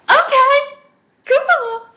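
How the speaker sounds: high, loud gleeful squeal; excited agreement. Retold or imitated. Acted reply to getting his number.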